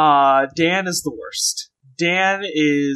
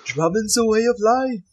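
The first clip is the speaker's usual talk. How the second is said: lazy silly Scouse musing; 6 semitones higher than usual. Pretend. Continues Beatle mockery.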